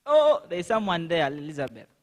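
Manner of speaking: ordinary talk, nothing marked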